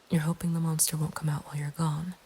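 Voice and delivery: ordinary delivery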